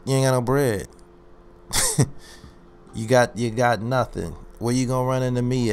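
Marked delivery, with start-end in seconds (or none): 1.7-2.2 short high cackle; a quick jeering laugh; mocking high laugh. Real, not acted. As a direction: laugh at him scornfully. Mocking caller.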